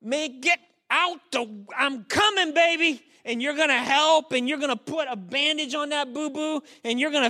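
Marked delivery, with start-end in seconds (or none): 0.0-3.0 high-pitched rush to the rescue; acted parental alarm; urgent parent. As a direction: call out as you hurry to a hurt child. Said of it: "When it's the real boo-boo cry".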